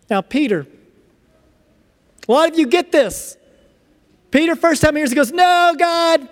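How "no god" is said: high, exclaimed protest; emphatic refusal. Acted. Peter refusing God's command to eat, emphatic protest. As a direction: cry out the refusal, pitched up.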